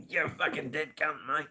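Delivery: high comic Australian mock abuse; Australian slang insult. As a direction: squeeze it out high in broad Australian.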